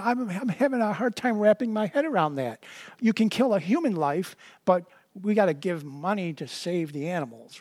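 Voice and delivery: ordinary delivery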